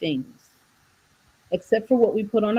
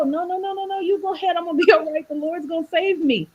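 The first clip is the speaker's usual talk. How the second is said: stubborn man on roof; 8 semitones higher than usual. Retold or imitated. Man on roof refuses rescue.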